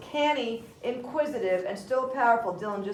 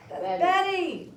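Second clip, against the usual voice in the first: high, drawn-out exclamation; stretched, raised-pitch exclamation; exclaimed cue line; 7 semitones higher, slower than usual. Shown as a demonstration. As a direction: call the name out high and slow. Teacher models the missed line.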